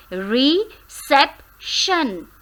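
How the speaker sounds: reception drawn out slowly, sep stressed; slow stress demo. Shown as a demonstration. Teacher slowly modelling reception, stress on sep. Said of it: "which syllable in this word is more stressed".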